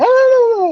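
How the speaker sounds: startled shriek, very high and loud; girlish scream. Retold or imitated. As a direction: mimic being caught by an icy surprise. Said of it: "'cause I scream like a girl".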